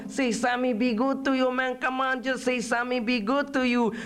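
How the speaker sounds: high, wheedling barrio voice; pleading sidekick. Acted. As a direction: coax the prisoner in a high voice. Coaxing figure in poem.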